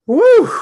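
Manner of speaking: high, very loud whoop of joy. Real, not acted. Coach firing up his live audience with a loud whoop. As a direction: let out a wild whoop. Cheers at his own speech.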